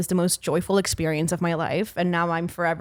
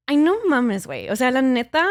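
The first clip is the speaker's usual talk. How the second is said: put-on higher-pitched, sing-song Mexican slang accent; 7 semitones higher, faster than usual.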